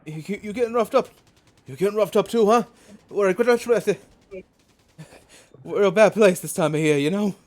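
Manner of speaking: high, loud, hurried banter; gruff soldier. Acted. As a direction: chatter breathlessly on the run. NPC soldier talking while rushing.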